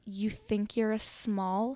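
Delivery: slow, drawn-out condescending question; mocking disbelief. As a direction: imitate someone doubting, word by word. Retells a dancer's mocking disbelief.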